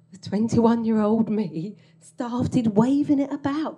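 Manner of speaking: ordinary delivery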